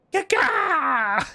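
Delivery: loud, high-pitched chicken cry; mock chicken squawk. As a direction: squawk out a silly, inaccurate chicken call. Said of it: "not the noise that chickens make".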